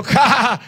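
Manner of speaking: imitated scornful guffaw; mock scoffing laugh. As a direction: laugh it out in derision. Man scoffs in retold story.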